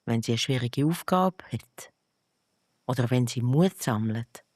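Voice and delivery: ordinary delivery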